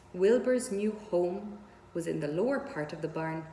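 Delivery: ordinary delivery, nothing marked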